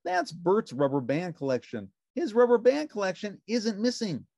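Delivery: high, bright puppet voice; cheerful little puppet; Ernie voice. A narrator's reading. Narrator voicing Ernie answering the phone, high puppet voice.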